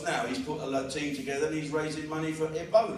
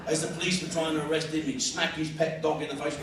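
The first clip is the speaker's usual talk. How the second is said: ordinary delivery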